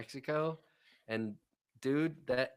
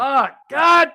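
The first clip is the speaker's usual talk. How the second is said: two high, loud bursts; exasperated shout; 11 semitones higher, 13 dB louder than usual. Real, not acted. Podcaster cursing his own wording, exasperated shout. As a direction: yell each word separately, sharply louder.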